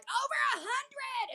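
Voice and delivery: high, proud mock shout without volume; acted calling out. Answering the host before the crowd, mock shout. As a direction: call it back across a room, held in.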